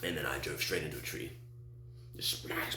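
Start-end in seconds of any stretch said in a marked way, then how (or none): 2.2-2.8 voiceless imitated smash; hushed crashing sound effect; crash noise. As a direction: make the impact with your mouth.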